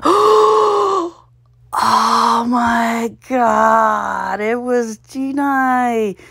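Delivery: gasp, then high, slow "oh my God"; stunned realization, drawn out; gasping disbelief. Real, not acted. Solver gasping at an absurd crossword answer, high and slow.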